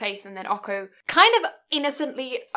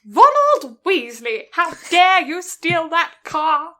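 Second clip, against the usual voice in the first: loud, slow outraged telling-off; hammed-up furious reprimand; mock scolding; 5 semitones higher, 7 dB louder, slower than usual. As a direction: berate him like a stern schoolmarm. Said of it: "scolding Ron as Hermione".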